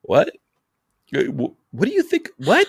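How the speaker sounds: high, rising disbelief; incredulous what. Retold or imitated. Hearing the four-hour invite, rising disbelief. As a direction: squeak out your shock. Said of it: "I'm like".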